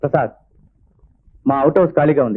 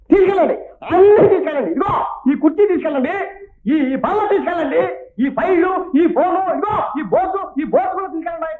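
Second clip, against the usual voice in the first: very high-pitched, frantic indignant rant; hysterical outburst. Acted. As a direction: wail each order as if losing everything. Jealous manager's hysterical outburst.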